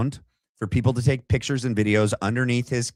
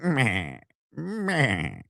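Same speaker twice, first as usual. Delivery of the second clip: evil purr; 4 dB quieter than usual. Retold or imitated. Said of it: "like Doctor Evil".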